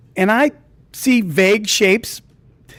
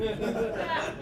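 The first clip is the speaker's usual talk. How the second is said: breathy laugh; 8 semitones higher, 12 dB quieter than usual. Real, not acted. Laughing at his own story.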